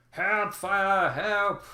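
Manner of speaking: high, loud cry for help; acted yell, drawn out and high; panicked goblin yelling. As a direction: call out the alarm as fleeing goblins. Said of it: "yelling, like".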